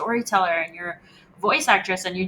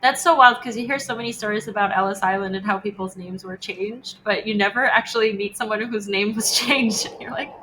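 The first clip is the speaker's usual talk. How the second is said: ordinary delivery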